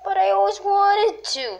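high, pleading whimper of a voice; whiny crybaby. Pretend. Creature whines to its partner about the water, high crybaby whimper. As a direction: whine it like a hurt little creature. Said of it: "Stop being a crybaby".

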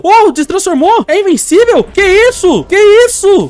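high, loud, slow incredulous yelling; disbelieving shout. Real, not acted. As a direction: yell in disbelief at the game. Titan beats him, can't believe. (grito incrédulo)

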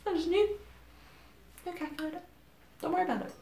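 softly feigned innocence; mock furtive. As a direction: trail into a sheepish little cover-up. Jokingly denying a hidden calculator.

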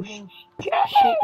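very high squeal of sheesh; squeaky sheesh. Shown as a demonstration. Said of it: "No go".

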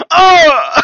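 sudden loud startled cry; startled shout. Real, not acted. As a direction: yelp of shock as he falls. Falls off the high stool.

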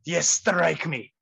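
put-on slow Russian-accented insistence; drawn-out, firm Russian accent. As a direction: repeat the order as the unbothered Russian boss. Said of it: "in a thick Russian accent".

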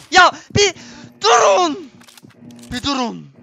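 real panicked yell; yelling stop. Real, not acted. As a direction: scream at them to stop. Under fire, yelling stop.

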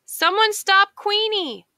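high, loud plea; call for help. A narrator's reading. Quail calls for help as his quilt is stolen, high loud plea. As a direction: shout for someone to stop the dog.

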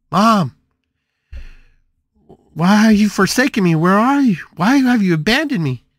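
loud, high, anguished cry for mom; desperate calling out. Retold or imitated. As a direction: call out as if nobody answers. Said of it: "calling for her mom".

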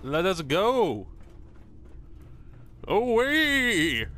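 high, drawn-out call to set off; hammed-up heroic send-off, stretched long; playful high holler; mock rallying cry. Calling out setting off in game.

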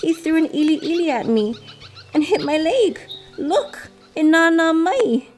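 high, aggrieved little bird voice; storybook wounded complaining, pitched high; hurt complaint. A narrator's reading. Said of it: "Limping, Elepaio answered".

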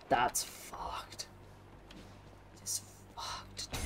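voiceless, hushed disbelief; very soft, whispered dismay; stunned whisper. Real, not acted. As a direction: whisper it, floored by the bad news. Stunned at losing all boons.

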